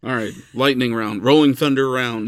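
loud booming round announcement; announcer shout. Pretend. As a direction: boom it like a game host.

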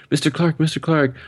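high-pitched imitation of a young student; excited kid. Student begging his teacher to come greet his mom, high kid voice. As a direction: do an eager kid calling the teacher. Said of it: "this kid comes up to me. He's like".

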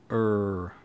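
a loud far-off rumbling roar; distant roar. A narrator's reading. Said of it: "All he could hear was".

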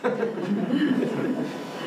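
breathy high laugh; quick amused laugh; soft unvoiced chuckle; airy laugh. Real, not acted. Laugh after joke about killing twice.

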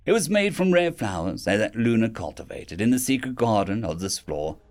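ordinary delivery, nothing marked

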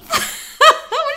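high laugh breaking into a squeal; high squeal of laughter. Real, not acted. Laughing at her own German attempt. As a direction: crack up, high and breathless.

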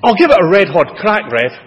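mock-enthusiastic, all in on it; eager reply. A boy answering the minister in class, keen and lively. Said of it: "don't get too enthusiastic".

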